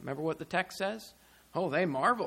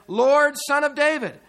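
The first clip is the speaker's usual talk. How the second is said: raised, higher quoted plea; louder, lifted cry of appeal; cry for mercy; 8 semitones higher, 10 dB louder than usual. Retold or imitated. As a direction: call out to the Lord for mercy. Voicing the woman's cry for mercy.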